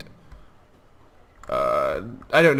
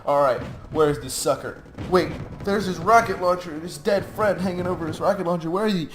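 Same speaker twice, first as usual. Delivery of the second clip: soldier voice; 8 semitones higher than usual. Retold or imitated. Voicing his soldiers searching.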